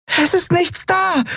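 high, drawn-out cry of alarm; breathless alarm. A narrator's reading. Nelen returning to burning bridge, breathless alarm. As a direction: report back in a slow high wail. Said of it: "ganz außer Atem".

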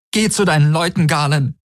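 high, wounded voice; each word like an open wound; raw with hurt. A narrator's reading. Kirai turns Galen away, deeply hurt. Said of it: "Ihre Stimme war wie eine rohe Wunde".

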